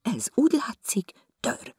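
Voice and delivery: breathy whisper to herself; whispered thought. Acted. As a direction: whisper the suspicion. (suttogott gondolat)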